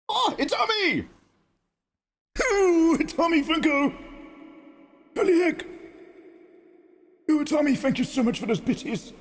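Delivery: high squealing delight; excited squeal. Real, not acted. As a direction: squeal with joy at the donation.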